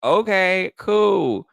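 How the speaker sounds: high, loud, sing-out mock approval; hammed-up, stretched-out reply; mock reaction. Host reacting to the bread commercial, exaggerated mock approval. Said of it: "And I was like".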